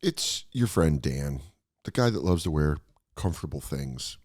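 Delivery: laid-back sponsor read. Acted. Host reading the Chubbies sponsor spot, ad read. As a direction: sell the shorts casually, friendly pitch.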